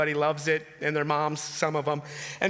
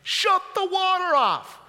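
high, drawn-out holler from the couch; mock yelling; 10 semitones higher, 3 dB louder, slower than usual. Husband on the couch to his wife doing laundry, mock yell. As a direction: slowly holler an order across the house. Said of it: "You're yelling at your wife".

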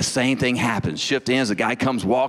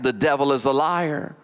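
normal talking voice

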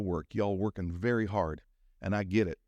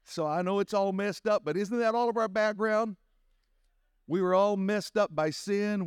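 much higher, ringing sermon cadence; full-force preaching; 9 semitones higher than usual. Real, not acted. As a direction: preach it to the rafters.

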